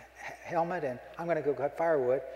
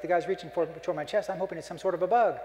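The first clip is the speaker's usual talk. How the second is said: normal talking voice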